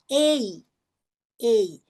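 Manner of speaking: "ei" as two joined sounds, e gliding into i; demonstrated "ei", both vowels heard; long A, two sounds. Shown as a demonstration. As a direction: say "ei" slowly as a diphthong. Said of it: "dois sons".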